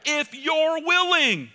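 slow, high, loud call for the church to repeat; leading the congregation. Shown as a demonstration. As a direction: sing it out slowly for all to echo. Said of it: "come on, say it with me".

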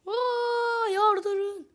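excited shout. Real, not acted. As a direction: urge everyone on, pumped up. Hyped gamer urging on.

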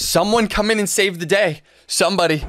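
high, loud desperate plea; pleading cry. Real, not acted. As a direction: beg anyone to save him. Begging for a rescue.

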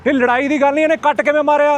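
high, heated shouting at a driver; real angry roadside argument; angry yelling. Real, not acted. Roadside quarrel with truck driver.

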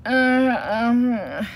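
loud, drawn-out hemming; embarrassed. A narrator's reading. Papa bear addressing the toy seller, embarrassed. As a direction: stall awkwardly on each filler sound. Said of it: "said embarrassed Papa".

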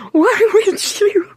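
high, slow, drawn-out disbelief; exasperated disbelief. Acted. Tony stunned that Peter swallowed a flashlight, high and slow.

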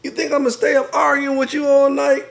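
high, loud indignant protest; exasperated quarrelling. Acted. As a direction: complain loudly at a bedmate. Acted bedtime quarrel.

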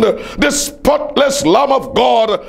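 ordinary talk, nothing marked

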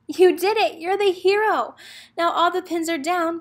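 put-on bright cheer for a young reader; excited cheer. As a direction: celebrate a strike like a proud coach.

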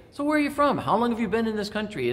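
high-pitched, curious small talk; friendly curiosity. Pretend. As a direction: pitch way up, eagerly quizzing a newcomer. Voices friendly curiosity toward foreigner.

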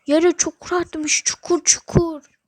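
loud slow scared wail; drawn-out fearful wail; frightened cry. Real, not acted. Scared by hole in floor.

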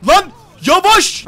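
yelling at a reckless driver, really shouting. Real, not acted. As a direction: shout at the road to slow down. Yelling at someone in traffic.

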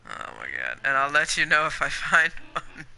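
normal talking voice

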